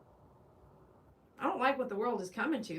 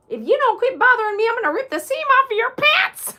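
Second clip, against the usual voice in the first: much higher, much louder hammed-up threat; mock angry threat; 12 semitones higher, 12 dB louder than usual. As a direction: bellow a silly threat in a put-on voice.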